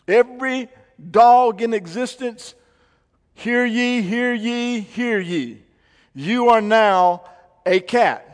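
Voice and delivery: loud, high proclaiming; town crier. Pretend. Mock royal proclamation about dogs.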